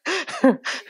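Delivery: short high laugh; a quick amused laugh; light laugh. Real, not acted. Speaker laughing after the husband story, a short high laugh.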